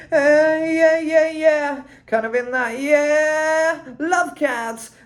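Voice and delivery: high British croon; high playful yeah-yeah croon. Shown as a demonstration. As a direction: find a high key and play. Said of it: "Find a higher kind of key".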